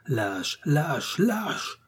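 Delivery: climbing cries as he collapses; fainting cries. A narrator's reading. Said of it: "en criant encore".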